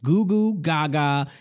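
normal talking voice

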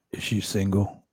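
low, hopeful wrestler impression; low-pitched, shameless question; Jake the Snake Roberts. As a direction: ask it slyly in a low pitch. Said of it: "And he's like".

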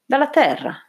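high-pitched, exasperated answer; irritated. A narrator's reading. Newly arrived visitor answering an absurd question, irritated. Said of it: "risposi con irritazione".

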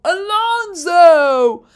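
high, very loud, long-drawn name; excited shout. Retold or imitated. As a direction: cheer the driver's name, stretching it out. Acting out excited written note.

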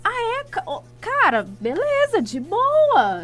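high, breezy fake nonchalance; fake casual. Girl answering boyfriend's rejection, faking high breezy calm. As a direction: pretend it doesn't hurt at all.

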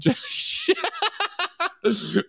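high, sudden burst of laughter; high real cackle of laughter. Real, not acted. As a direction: crack up at the clip. Laughing at breaking news clip.

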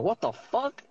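high-pitched retold outrage; mad. Parent reacting to cornflakes with orange juice, mad. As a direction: exclaim it high in indignant disbelief. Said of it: "so I was mad".

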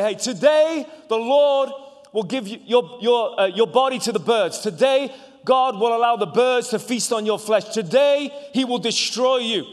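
high, loud battle cry at the giant; defiant shout. Acted. David shouting down Goliath before the fight, loud and defiant. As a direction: hurl it at Goliath like a war cry.

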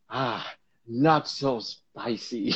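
very slow, drawn out; slow deadpan. Retold or imitated. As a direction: drag the words lazily. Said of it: "he's like".

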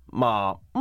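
まあ held low and dipping; third tone. Shown as a demonstration. Teacher listing four tones, low dipping まあ. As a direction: keep まあ down low. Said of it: "第一声から第四声まであります".

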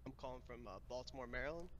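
faint voice, far from the mic; barely audible, distant microphone; very quiet. Real, not acted. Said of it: "you are super quiet".

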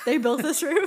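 loud, high, amused disbelief; laughing question. Real, not acted. Laughing at the other's claim.

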